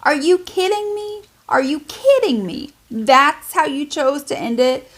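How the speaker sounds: high, incredulous "are you kidding me"; rising outrage at a bad ending; disbelief. A reader fuming at the book's ending, incredulous. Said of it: "I was like".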